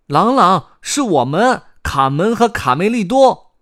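high, loud youngster announcing themselves; 卡梅利多. A narrator's reading. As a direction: call out eagerly in a high child-character voice. 卡梅利多喊野兔. (高亢响亮地自报姓名)

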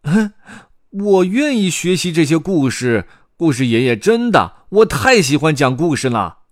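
high, loud youngster; squeaky, insistent offer; eager volunteering. A narrator's reading. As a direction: pipe up with keen excitement. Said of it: "卡梅利多自告奋勇".

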